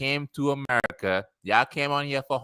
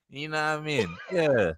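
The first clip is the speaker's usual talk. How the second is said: ordinary delivery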